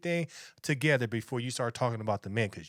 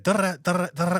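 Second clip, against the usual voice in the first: mock yelling; 6 semitones higher, 7 dB louder, faster than usual. Mocking women yelling.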